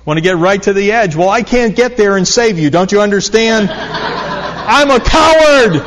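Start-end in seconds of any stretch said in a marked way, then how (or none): 1.2-5.8 higher, louder plea that dissolves into a laugh; mock pleading. Parent admitting to the kids she's a coward, laughing. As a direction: beg them to understand, laughing at yourself.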